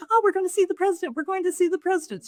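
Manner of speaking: high, fast, breathless excitement; young woman squealing about seeing the president; excited gushing. Retold or imitated. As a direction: gush like a thrilled fan bouncing in her seat. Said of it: "She was so happy and, uh, so excited".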